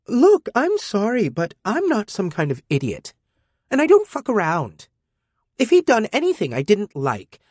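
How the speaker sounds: put-on high, scornful overconfident girl. A narrator's reading. Defiant teen girl lecturing her guardian robot, scornful. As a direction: brush him off, cocky and scornful. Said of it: "She was scornful and way overconfident".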